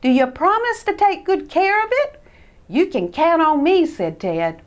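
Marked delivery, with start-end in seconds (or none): 0.0-2.2 high, uneasy little mouse voice; hesitant Christopher. Acted. Christopher unsure about leaving his new sled. 2.7-3.9 put-on higher, louder wheedling boy; Ted persuading. Ted persuading his cousin to trust him.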